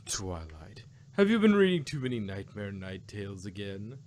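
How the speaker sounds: a gentle mentor dismissing the worry; Celestia voice. Acted.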